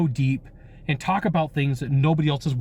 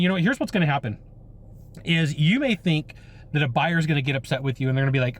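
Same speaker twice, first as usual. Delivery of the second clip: normal talking voice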